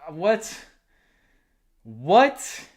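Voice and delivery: can't believe it, asking twice; stunned disbelief. Real, not acted. Vlogger reacting to the coach retiring, stunned rising what.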